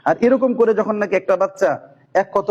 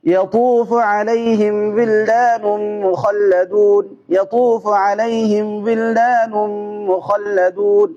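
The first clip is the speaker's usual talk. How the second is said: quicker chanted verse, repeated; fast recitation; 3 semitones higher, much slower than usual. Shown as a demonstration. Teacher demonstrating the fast reading stage. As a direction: rattle through the verse faster. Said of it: "এরপরে দ্রুত করে".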